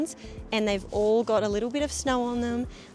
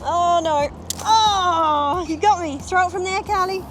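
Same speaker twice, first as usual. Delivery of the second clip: excited; 8 semitones higher, 8 dB louder, slower than usual. Real, not acted. Hit by snowballs.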